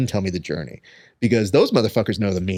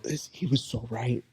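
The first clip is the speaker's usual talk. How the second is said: awed hush; 10 dB quieter than usual. Retold or imitated. Awed confession to wife.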